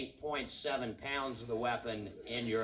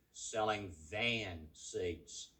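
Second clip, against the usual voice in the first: slow punchline delivery; 3 semitones lower, 4 dB quieter, much slower than usual. Real, not acted. Punchline slowed for effect.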